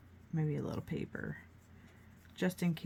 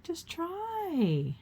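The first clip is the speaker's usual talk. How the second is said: encouraging mom; 12 semitones higher than usual. Retold or imitated. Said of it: "she'd be like".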